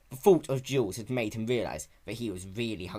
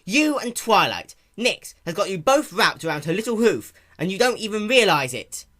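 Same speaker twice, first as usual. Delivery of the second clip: high, louder accusing voice; bitter accusation; 8 semitones higher, 8 dB louder than usual. Acted. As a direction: throw the accusation over your shoulder. Spike accusing, storming off.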